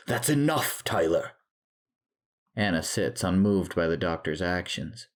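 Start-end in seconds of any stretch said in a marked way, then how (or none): 0.1-1.3 high reprimand, falling on the name; yelling. A narrator's reading. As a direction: rein him in with a moderate yell. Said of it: "Doctor Stadler yells".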